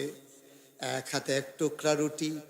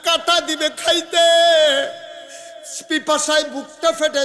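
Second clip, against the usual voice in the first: loud, high melodic preaching; full-force sermon; 10 semitones higher, 8 dB louder than usual. Acted. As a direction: chant it out to the crowd, drawing out line ends. Preacher warns of hellfire.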